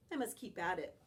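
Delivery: ordinary delivery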